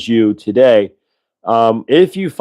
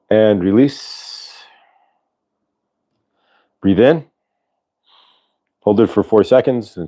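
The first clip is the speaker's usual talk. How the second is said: guided breathing. Acted. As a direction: lead the breath gently, taking your time. Leads a breathing exercise.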